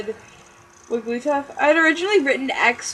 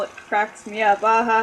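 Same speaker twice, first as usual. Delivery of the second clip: plain reading voice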